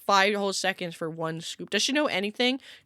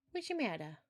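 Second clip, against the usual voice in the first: softly to cat; 2 semitones higher, 11 dB quieter than usual. Real, not acted. Soft talk to cat.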